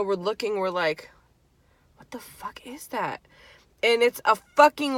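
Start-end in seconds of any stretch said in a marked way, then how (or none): 2.0-3.2 scared breathless whisper. Retold or imitated. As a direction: whisper it so the creatures won't hear. Spotting creatures in graveyard.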